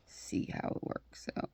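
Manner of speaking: soft whispered repeat. Shown as a demonstration. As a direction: whisper it to yourself. Repeats phrase, second time whispered.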